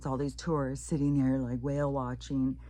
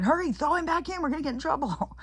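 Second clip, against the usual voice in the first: much higher, urgent mock voice; panicked guide; 14 semitones higher, 5 dB louder, faster than usual. Imagined tour guide panicking about trouble. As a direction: rush them in alarm. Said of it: "He wasn't saying".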